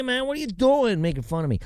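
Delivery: ordinary delivery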